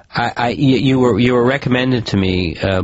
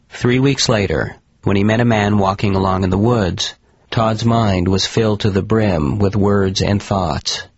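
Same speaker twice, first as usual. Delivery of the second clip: plain reading voice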